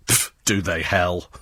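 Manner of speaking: slow, low scoff; a slow, disbelieving scoff. Real, not acted. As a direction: sneer it off with a puff. Scoffing dismissal.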